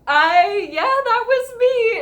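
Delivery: high, loud, voice breaking into a cry; real tearful outburst of joy. Real, not acted. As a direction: confirm it while overcome with tears. Overwhelmed confirming it was her.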